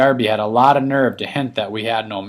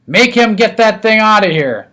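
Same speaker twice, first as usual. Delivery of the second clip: loud, higher angry demand; booming demand; 9 semitones higher, 6 dB louder than usual. A narrator's reading. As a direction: order the host to get rid of the turtle. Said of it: "Mister Yarby boomed".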